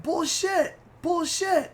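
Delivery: high-pitched scoffing imitation; imitated high indignant protest; mock indignant denial. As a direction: protest it high, like a sore loser. Imitating friend's disbelief.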